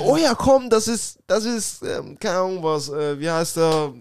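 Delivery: high, eager voice of the pushy boss; chirpy fake enthusiasm; mimicking the boss. Boss urging him to dance, high eager mimicry. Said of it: "Sie sagt so".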